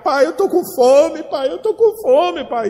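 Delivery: put-on high, slow childlike whine; hungry child pleading. Hungry son begging his father for food, high whiny plea. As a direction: beg like a hungry little boy tugging at dad. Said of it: "agarrado na calça do pai".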